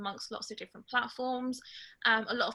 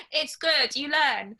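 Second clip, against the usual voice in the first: a loud laughing reply, still amused; booming out the words through leftover laughter; 5 semitones higher, 8 dB louder, slower than usual. Real, not acted. Teased by listeners for shouting, answers loud and laughing. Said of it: "You shout a lot at us".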